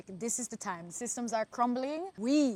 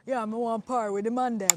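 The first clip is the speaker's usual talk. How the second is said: quick London street slang voice; imitating London roadman talk; London roadman accent; 6 dB louder than usual. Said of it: "every time I hear Londoners say".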